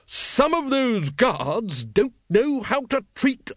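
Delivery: high, slow put-on noblewoman; Lady Ramkin. A narrator's reading. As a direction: play the big indignant aristocrat, slowly. Lady Ramkin voiced in story.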